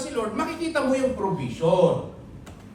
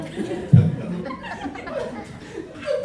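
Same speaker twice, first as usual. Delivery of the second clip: high squealing laugh; 4 dB louder than usual. Real, not acted. Preacher laughing at his own mock complaint, a high squeal.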